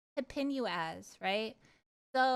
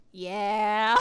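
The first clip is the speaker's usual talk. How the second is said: normal talking voice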